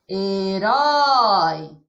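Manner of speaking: herói with RÓI held very long; stress on last syllable. Shown as a demonstration. Teacher showing how we pronounce herói, RÓI stressed. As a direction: slowly swell and stretch the final RÓI of herói. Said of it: "Olha como nós pronunciamos".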